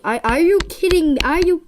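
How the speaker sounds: high, loud, fast are you kidding me; outraged at the stuck slime; high, loud exasperation; exasperated disbelief. Real, not acted.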